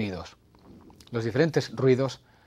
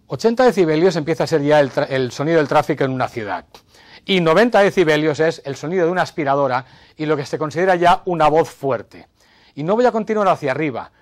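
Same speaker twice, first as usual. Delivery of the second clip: much louder and higher, projecting hard; raised voice; 4 semitones higher, 8 dB louder than usual. Shown as a demonstration. Host raising his voice to demonstrate ninety decibels. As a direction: build the volume up like traffic noise. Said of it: "alzando mi voz".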